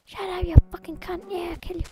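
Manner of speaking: high, loud hammed-up threats at a monster; mock furious yelling. Yelling at a game mob.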